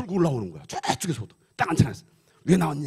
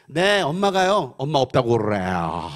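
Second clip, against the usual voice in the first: put-on slow, singsong little-kid answer; innocent child; 2 semitones lower, 5 dB louder, slower than usual. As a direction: imitate a child artlessly giving away the secret. Young child answering pastor's phone call.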